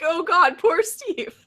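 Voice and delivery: mock pity. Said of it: "now I'm all like".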